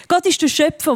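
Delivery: ordinary talk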